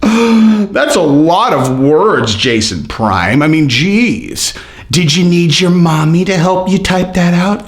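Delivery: slow, gasping sarcastic awe; mock amazement. Mocking a commenter for writing so much, sarcastic awe. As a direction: fake being impressed to mock him.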